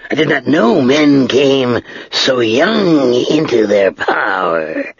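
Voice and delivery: slow, drawn-out, dry dragon voice; great dry voice of an ancient dragon. Acted. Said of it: "the dragon said".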